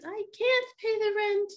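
high pleading melodrama damsel; damsel in distress. Acted. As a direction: squeal it out like a helpless heroine. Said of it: "damsel answers villain".

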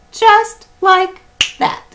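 very high, loud and slow; playful high voice. Acted. Reading aloud, the catch said very high and slow. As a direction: stretch it out squeaky and high. Narrator mimics quick catch.

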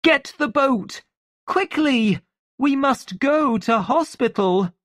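urgent, high-pitched orders; high, alarmed urgency; frightened cry. A narrator's reading. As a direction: cry out for help in a panic. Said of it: "she cried".

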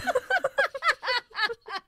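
high, helpless burst of laughter; hearty laughter. Real, not acted. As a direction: laugh until it squeaks. Both crack up at rice stuffing.

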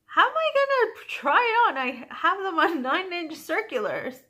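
sudden high, loud squeak of alarm; surprised friend. Retold or imitated. Knitting friend startled at being asked to try on socks, high loud squeal. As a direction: play the friend caught out, pitching way up.

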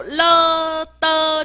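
了 and 的 drawn out high as two separate sounds; 分解发音示范. Shown as a demonstration. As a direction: stretch 了, then 的, to show the parts. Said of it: "就是说有了的整合一下". (把“了”“的”分开拉长示范)

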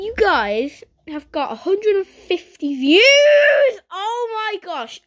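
high, loud, near-crying squeal; overwhelmed with joy, voice cracking; tearful excitement. Real, not acted. Thanking viewers for views, a tearful excited squeal. Reacting to a hundred fifty views.

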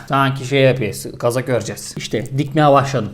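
usual speaking voice

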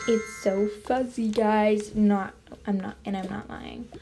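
ordinary delivery

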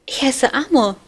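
put-on high, loud boyish voice; cheeky little boy. A narrator's reading. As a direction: play the mischievous child Amor. Amor voiced by narrator. (hohe, laute, freche Knabenstimme)

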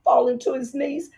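usual speaking voice

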